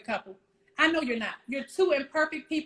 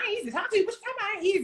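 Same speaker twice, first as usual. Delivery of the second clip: higher, indignant mock protest; defensive; 8 semitones higher, much faster than usual. Wife mocking her own defensive reply to husband, higher and indignant. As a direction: protest like you're offended. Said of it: "Look, defensive".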